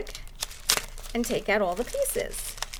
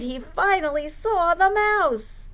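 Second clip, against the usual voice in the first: ordinary delivery, nothing marked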